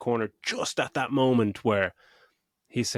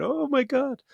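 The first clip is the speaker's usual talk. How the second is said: very high, hammed-up gasp; overacted fake shock, pitched way up; mock actor gasp; slower than usual. Bad actors selling a fast lift, very high mock gasp. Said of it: "They're all just gonna move".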